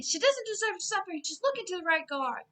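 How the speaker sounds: high, loud urgent plea; pleading scream. Retold or imitated. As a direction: scream it out on her behalf. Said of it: "He was the voice that was screaming".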